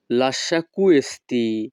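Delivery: each Italian word spaced out very slowly; slow teaching model. Shown as a demonstration. Teacher models Italian phrase for students, very slow. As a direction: spell it out slowly for learners to copy. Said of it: "Italian ভাষা".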